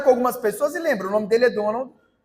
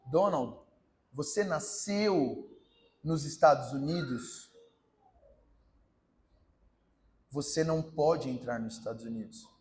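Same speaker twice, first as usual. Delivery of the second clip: stern immigration officer; 8 semitones lower, 9 dB quieter than usual. Acted. Immigration officer questions Donald.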